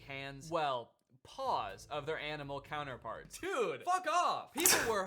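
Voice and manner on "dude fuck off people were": slow, much higher disbelief; incredulous. Real, not acted. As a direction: drag it out in disbelief. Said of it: "disbelief at the injuries".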